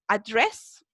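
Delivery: "Address" with an American r, a-DRESS; gringo accent. Shown as a demonstration. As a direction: give "Address" an Americanized Andrés sound, rising at the end. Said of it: "Ahí agringado".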